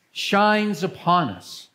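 ordinary delivery

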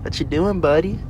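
high sweet talk to a kitten; cat voice. Real, not acted. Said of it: "Sorry for my cat voice".

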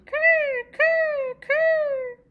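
high, loud gull calls "Kerr, kerr, kerr"; gull cries. Acted. Seagulls crying as they fly, high, loud squawks. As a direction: screech like seagulls taking off.

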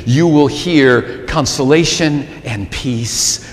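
ordinary talk

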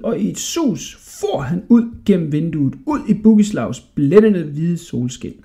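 usual speaking voice

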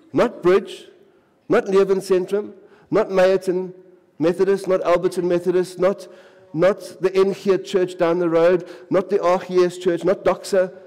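high, loud emphatic list; fiery preacher list; preaching at full force. Acted. Listing churches, rising intensity.

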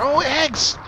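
high excited yelp. Real, not acted. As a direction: jump up in pitch, thrilled. Spots eggs excitedly.

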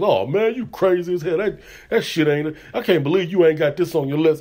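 disbelieving fan calling out the ranking, mock outrage; mock outraged critic. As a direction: play a listener scoffing at your list. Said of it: "them motherfuckers".

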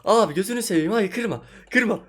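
begging the enemy not to break his bed; desperate pleading. Real, not acted. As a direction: beg the player to stop.

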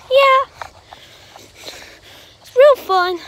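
high squeaky friend voice; Chica character. Pretend. Chica agrees with Bonnie about YouTube, high squeaky voice. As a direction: chirp it high and drawn out.

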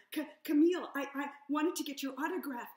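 flustered fan stammering to the singer. Acted. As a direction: trip over the words, star-struck. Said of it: "I stammered".

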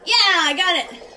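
loud, high, drawn-out cheer; slow, loud whoop of success; triumphant shout. Real, not acted. Celebrating a success in game.

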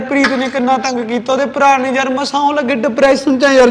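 usual speaking voice

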